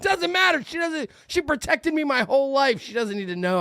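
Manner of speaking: high-pitched mock indignation; retold sibling squabble, high and worked up; exasperated protest. Acts his exasperated protest to brother.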